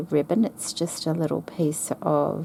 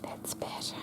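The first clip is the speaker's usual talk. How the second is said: soft relieved whisper; 15 dB quieter than usual. Real, not acted. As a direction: breathe it out in a whisper. Muttering to herself after tidying.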